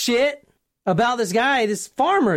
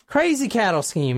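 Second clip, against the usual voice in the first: usual speaking voice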